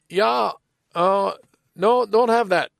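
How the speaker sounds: lightly voiced Chinese-accented English, clipped phrasing; imitating a Chinese accent. Restaurant worker telling the caller the dish is unavailable, mild Chinese accent imitation. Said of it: "making fun of a bad Chinese accent".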